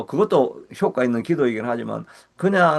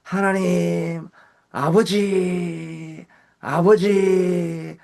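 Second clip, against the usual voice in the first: very slow, higher, drawn-out calling of Father; yearning prayer cry; 5 semitones higher, much slower than usual. Shown as a demonstration. Pastor showing congregation how to call on the Father, slow high yearning cry. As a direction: stretch each 아버지 out, reaching toward God. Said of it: "하고 부르는 기도가".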